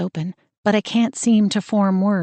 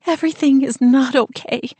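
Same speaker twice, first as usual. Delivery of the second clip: higher, louder voice choking back sobs; holding back sobs; 7 semitones higher, 4 dB louder than usual. Acted. Frightened sister on the phone, fighting back sobs. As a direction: fight the tears but they break through. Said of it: "trying to contain what sound like sobs as she whispers".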